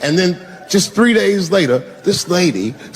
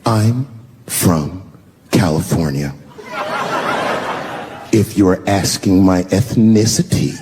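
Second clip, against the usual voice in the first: lower, prim condescending voice; 9 semitones lower than usual. Retold or imitated. The offended wife answering the comic's question, condescending and lower. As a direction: talk down to him, slow and precise. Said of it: "very condescendingly".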